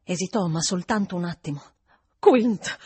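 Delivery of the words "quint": a sudden louder burst of the name; exclaiming. A narrator's reading. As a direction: blurt the name out. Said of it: "esclamò".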